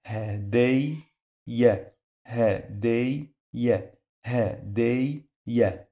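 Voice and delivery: hadayya broken as ha-day, pause, ya; sukun then fatha. Shown as a demonstration. Teacher showing sukun then fatha, word split in two. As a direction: stop on day in hadayya, then release ya separately. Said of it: "The first time we pronounce it with sukun".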